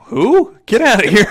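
high, loud laughing brush-off; mock bouncer. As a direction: shoo them off like a joking bouncer. Mock bouncer turning girls away.

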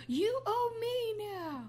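high, very drawn-out mock claim; mock demand. Delivery man claiming payment after sex, drawn-out mock demand. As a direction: stretch it out slowly, high in pitch.

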